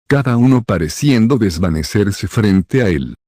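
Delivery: usual speaking voice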